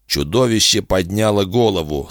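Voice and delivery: plain reading voice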